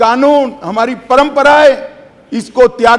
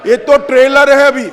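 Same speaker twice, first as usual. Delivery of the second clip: raised, taunting retort to hecklers; 4 semitones higher than usual. Real, not acted. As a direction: fire it back at the benches.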